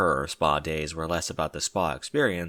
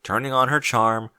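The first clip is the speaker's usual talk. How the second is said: ordinary delivery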